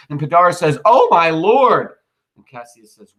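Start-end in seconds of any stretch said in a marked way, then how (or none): 0.8-1.9 drawn-out, high, loud "Oh, my lord" in alarm; alarmed cry. Acted. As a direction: gasp it out as bad news dawns. Pindarus sees Titinius surrounded.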